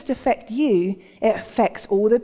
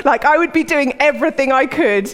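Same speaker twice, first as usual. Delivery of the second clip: raised, excitable voice with amusement; comic frantic avoidance; 4 semitones higher, 4 dB louder than usual. Acted. Preacher imagining dodging Peter's awkward moment, comically frantic. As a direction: keep it high and hammed-up, half laughing.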